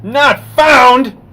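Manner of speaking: loud, high cry of disbelief at the screen; frustrated outburst. Real, not acted. Vlogger reacting to a broken link: loud, high exasperated cry. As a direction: blurt it out, high and loud, when the link fails. Error page after typing URL.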